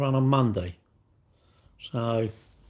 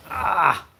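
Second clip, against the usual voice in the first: sharp real cry of rib pain, a little louder; 8 semitones higher, 4 dB louder than usual. Real, not acted. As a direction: yelp as sore ribs twinge. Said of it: "it hurts".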